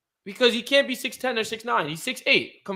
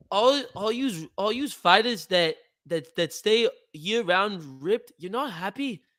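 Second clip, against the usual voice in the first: put-on higher-pitched Scouse accent; Patty the Batty; 5 semitones higher than usual. Podcaster playing fighter defending his diet, put-on Scouse accent. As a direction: imitate a Liverpool fighter, lilting Scouse. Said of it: "the Scouser. It's the Scouser".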